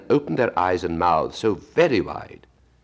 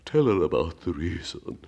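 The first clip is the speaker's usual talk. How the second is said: Mock Turtle voice. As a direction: drag it out like a sad old turtle.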